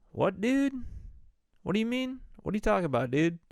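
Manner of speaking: high, incredulous voice; retold puzzled reaction; mock bewildered questioning. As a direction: act out baffled disbelief, pitched right up. Acted puzzled reply to panicked friend.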